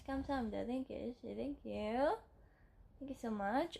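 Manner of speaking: ordinary talk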